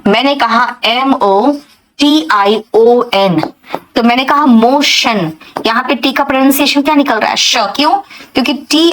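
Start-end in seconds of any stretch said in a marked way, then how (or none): 0.8-3.5 spelling slowly. Shown as a demonstration. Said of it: "ध्यान से सुनना". 4.0-5.3 motion said clearly with a sh sound, as moshan; clear pronunciation. Shown as a demonstration. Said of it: "ध्यान से सुनना".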